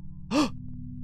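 startled gasp. Real, not acted. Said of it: "That actually startled me".

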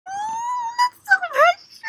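high, slow coo; swooning coo. Real, not acted. Fan melting, high and slow.

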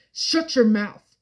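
sharp, loud, slow command; mock-angry loud shout; loud snap. A narrator's reading. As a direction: bark it out at his father. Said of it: "it was supposed to be low".

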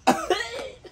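sharp choking cough; wasabi cough. Real, not acted. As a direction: cough hard on the burn.

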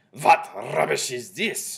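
loud, slow demand with rolled R; shouted. A narrator's reading. Grand High Witch rebuking her witches, shouted. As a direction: thunder it, stretching each word. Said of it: "shouted the Grand High Witch".

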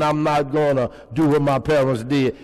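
ordinary talk

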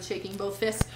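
usual speaking voice

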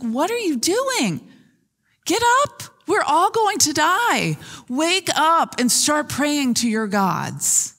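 high, urgent cry to wake a sleeper, slowing at the end; alarmed captain shouting. Acted. Captain rousing Jonah below deck, alarmed high shout. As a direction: jolt him awake in a panic.

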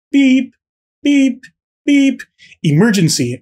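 high, loud mock beeps; alarm beeps. GM mimicking the stadium alarm, high beeps. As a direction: beep like an alarm going off.

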